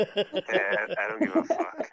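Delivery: high, loud words through laughter; laughing. Real, not acted. Caller told he's live on the show, laughing defiance. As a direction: laugh it off while talking.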